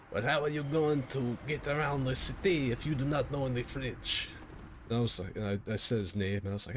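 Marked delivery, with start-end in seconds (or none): 0.1-4.3 higher mock French-Canadian accent; hammed-up French-Canadian father; French-Canadian dad. Girlfriend's French-Canadian dad, acted.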